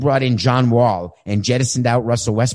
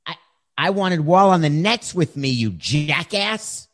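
indignant; 8 semitones higher than usual. Pretend. Harden snaps at owner.

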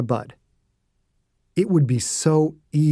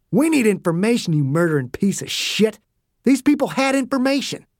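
heated, pitch jumping high; indignant, high-pitched outburst; angry rebuke; 7 semitones higher than usual. A narrator's reading. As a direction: berate him in rising indignation. Dew angrily rebukes the murderer.

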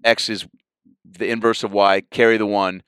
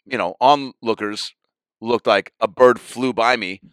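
usual speaking voice

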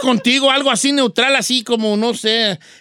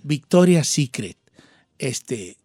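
very low, much softer, slow lingerie-ad voice; sexy ad voice; 11 semitones lower, 5 dB quieter, slower than usual. Shown as a demonstration. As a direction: linger low over the slogan. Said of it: "su frase va a ser".

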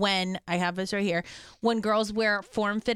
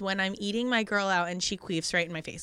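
normal talking voice

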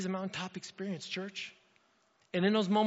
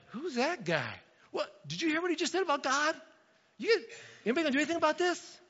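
put-on high-pitched, incredulous boy; young David; 8 semitones higher than usual. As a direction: question everyone in disbelief, pitch jumping up. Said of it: "And David's like".

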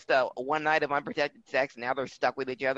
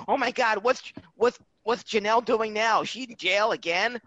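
higher-pitched gossipy fan reaction; 7 semitones higher than usual. Retold or imitated. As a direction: eagerly imitate following tabloid gossip. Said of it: "I would follow them".

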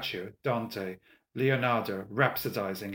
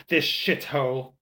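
slow, raised outburst; louder, higher exclamation; yell; 4 semitones higher, 6 dB louder, slower than usual. A narrator's reading. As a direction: yell it out, drawing out the words. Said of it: "he yelled in Italian".

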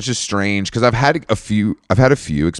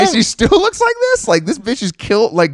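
much higher, incredulous pitch; shocked disbelief; 12 semitones higher than usual. Retold or imitated. Retelling his shock at the photo to his cohost, in high disbelief. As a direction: squeal it in disbelief.

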